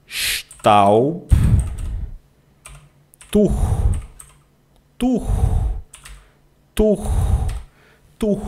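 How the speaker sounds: Tuch with the ch blown at the back of the throat; throat ch sound. Shown as a demonstration. Teacher drills throat sound for students, breathy. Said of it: "É um soprado no fundo da garganta".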